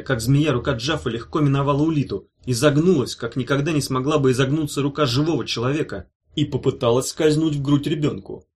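plain reading voice

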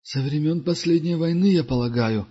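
lightly voiced wise old pilgrim; Duga's voice. (голос мудрого странника)

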